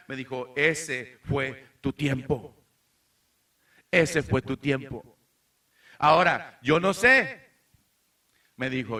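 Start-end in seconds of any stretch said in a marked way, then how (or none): 0.5-7.3 louder, firm, mock scolding of a wife; hammed-up stern wife. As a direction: lay down the law like his wife. Wife confronting him, retold.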